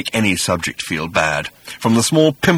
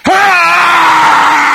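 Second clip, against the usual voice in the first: a huge, high, far-off creature cry of triumph; monstrous shout; 10 dB louder than usual. Acted. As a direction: bellow "Ah!" way up high and loud like a beast. Creature bursts out at Arthur.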